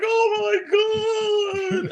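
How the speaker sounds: high, loud horrified gasp of a bystander; startled neighbors crying out at a tumbling kid; shocked shriek. Retold or imitated. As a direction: shriek it like you just saw a crash. Ladies seeing him crash.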